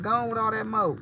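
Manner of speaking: imitating a Southern nephew, dropping the s in smoke; country nephew accent. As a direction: mimic his country moke for smoke. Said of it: "He be like".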